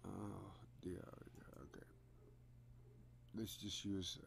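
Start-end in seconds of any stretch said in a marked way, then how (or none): none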